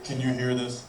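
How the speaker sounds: low, soft, as if saving the world; self-important stoner. Retold or imitated. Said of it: "he thinks he's saving the world".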